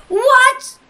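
high, loud startled cry; shocked what. Real, not acted. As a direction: jump at the sudden turn. Shocked gamer outburst.

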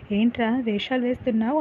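usual speaking voice

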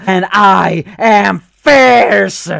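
slow, building to loud roar; fierce roar. Acted. Raptor slowly builds to a loud fierce roar. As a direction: roar the last word.

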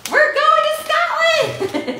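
high, loud, slow triumphant cry of SCOTLAND; mock announcement. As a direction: proclaim it like a big reveal. Announces, then "just kidding.

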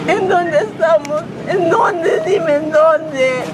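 crying, words breaking up; sobbing out the plea; blubbering. Real, not acted. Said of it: "Ella lloraba desconsolada".

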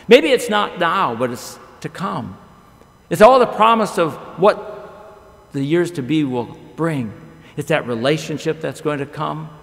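normal talking voice

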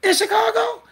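high, loud sarcastic mock; mocking question. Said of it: "I was like".

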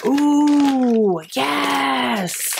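real thrilled shout of delight; delighted outburst. Real, not acted. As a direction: squeal with joy at the gift. Unwrapping book, delighted outburst.

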